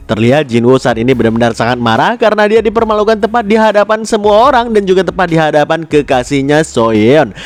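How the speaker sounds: usual speaking voice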